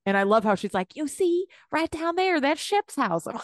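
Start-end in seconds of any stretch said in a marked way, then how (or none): 0.9-3.3 hammed-up Southern accent, pitched higher; exaggerated Southern drawl, high and sing-song; extreme Southern accent. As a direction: overdo her Southern accent as a joke. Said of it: "making her accent so extreme".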